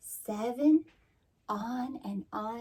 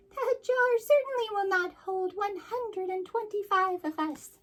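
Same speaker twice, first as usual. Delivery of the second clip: very high haughty bee queen; 13 semitones higher, 3 dB louder than usual. Acted. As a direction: look down on the jar in a high bee voice.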